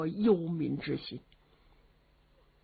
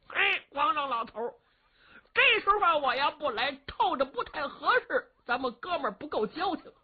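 brash simpleton warrior; 9 semitones higher than usual. Acted. Young warrior cheekily addresses the old emperor, high and brash. Li Yuanba voiced by storyteller.

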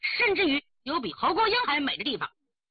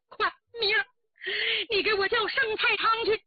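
high, agitated command to a maid; urgent order; 9 semitones higher than usual. Acted. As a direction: send the servant off at once. Mistress urgently orders her maid. (急切尖声地下令)

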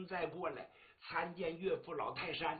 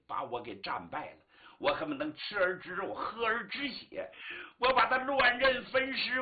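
furious tirade; 4 semitones higher, 5 dB louder than usual. Acted. Father disowning and threatening his daughter, furious. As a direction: rant building to a high, loud threat.

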